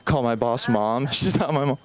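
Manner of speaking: normal talking voice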